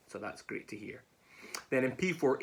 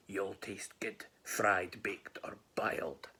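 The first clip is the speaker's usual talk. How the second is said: low, hushed monster relish; smiling hungry gruffalo; 3 semitones lower, 4 dB quieter, much slower than usual. Acted. Gruffalo sizing up the mouse, low quiet relish. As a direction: savour the menu with a smile. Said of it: "and the gruffalo smiled".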